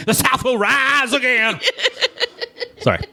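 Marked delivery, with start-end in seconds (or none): 0.1-1.6 high, hammed-up Southern cry; mock Southern accent, pitched way up with laughter. Host clowns for laughing co-host, hammed-up Southern accent. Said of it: "I always give it a Southern accent".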